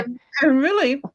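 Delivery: talking through a mouthful; mouth full. Real, not acted. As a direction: speak around a bite of food. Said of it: "I'm talking with my mouth full".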